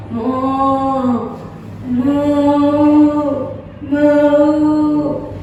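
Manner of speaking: long monotone imitated moos; cow mooing. Shown as a demonstration. Teacher giving children a milk animal clue, long mooing. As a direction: moo slowly like a cow, held on one note. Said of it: "It sounds like this".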